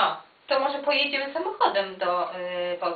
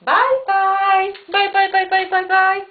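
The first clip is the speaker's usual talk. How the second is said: sing-song goodbye; 6 semitones higher, 6 dB louder than usual. Real, not acted. Calling goodbye to child.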